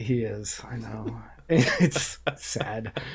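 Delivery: hushed, voiceless aside; whispered dismay at the president. Real, not acted. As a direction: whisper it like a sad secret. Sad aside about president.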